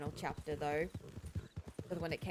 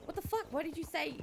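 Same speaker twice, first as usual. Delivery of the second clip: high-pitched mock disbelief; retold indignant questioning; 9 semitones higher than usual. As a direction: demand an explanation, voice shooting up. Jin confronts Isabella after her joke.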